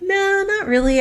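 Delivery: high-pitched, reluctant brush-off; retold polite refusal. She answers the boss's job offer, reluctant. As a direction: act out turning an offer down lightly.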